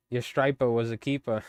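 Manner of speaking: imitated Maine fisherman, dropped final r's; heavy Maine accent. Imitating an angler praising a catch, heavy Maine accent. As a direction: do a Maine accent on striper and keeper. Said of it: "a heavy Maine accent".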